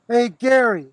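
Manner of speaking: calling out across the creek; calling from far away. Real, not acted. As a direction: shout his name into the distance. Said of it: "Is that the best you can yell?".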